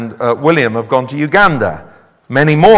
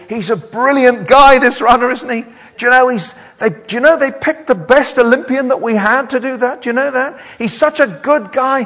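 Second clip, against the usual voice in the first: higher, gushing with delight; excited admirer; 11 semitones higher, 3 dB louder than usual. Pretend. Townspeople gush about the runner, excited. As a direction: chatter like a thrilled fan. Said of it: "You're gonna say".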